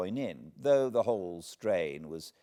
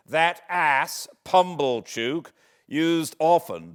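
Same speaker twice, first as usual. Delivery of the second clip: louder, higher scornful outburst; scornful jab; 6 semitones higher, 9 dB louder, slower than usual. A narrator's reading. Pip naming the hated Pumblechook with loud scorn. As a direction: spit the name with contempt.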